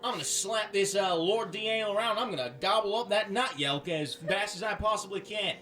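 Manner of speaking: hammed-up Australian dragonborn accent. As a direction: swagger it out in a broad Australian accent. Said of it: "Australian accent".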